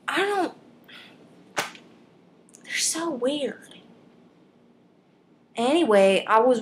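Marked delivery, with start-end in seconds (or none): 0.1-3.8 high, very slow, soft "they're so weird"; creeped-out drawl. Pretend. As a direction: stretch out "so" in slow disbelief. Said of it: "with my voice to express the weirdness".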